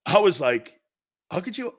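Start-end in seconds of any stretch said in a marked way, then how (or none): 1.3-1.8 mock-shocked, pitched up with a rise; exaggerated dismay. Shown as a demonstration. Host mocking how he didn't confront GM, mock-shocked. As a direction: ham up the outrage, then take it back. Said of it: "I mean, I didn't say it like that".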